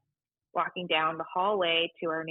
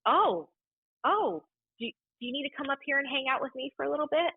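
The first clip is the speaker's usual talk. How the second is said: higher, caring boss voice; concerned boss; 8 semitones higher than usual. Retold or imitated. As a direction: sound like a worried boss offering comfort. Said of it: "And she goes".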